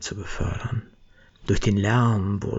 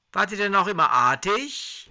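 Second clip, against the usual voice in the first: mock Santa; 12 semitones higher than usual. Herbie teases the old couple.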